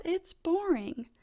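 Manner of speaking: lightly acted bored child; bored complaint. A narrator's reading. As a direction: drag it out in mild protest. Peppa complains about the book.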